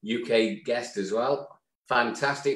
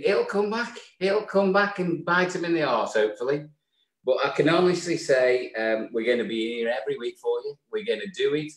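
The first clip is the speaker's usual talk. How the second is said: ordinary talk, nothing marked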